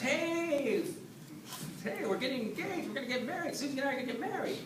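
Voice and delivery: high, fast, giddy announcing of the engagement; excited announcement. Retold or imitated.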